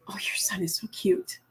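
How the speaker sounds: breathy mock-sweet whisper of the quote. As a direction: whisper it as if imitating the doctor. Mocking the doctor's words to mother.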